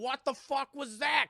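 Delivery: high, loud, drawn-out disbelief; hammed-up startled sister; mock shock. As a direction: cry it out slowly, aghast. Sister shocked after the shaking.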